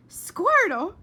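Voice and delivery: very loud, high amused question; delighted surprise. Acted. Flora delighted by the silly typed word. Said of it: "said Flora out loud".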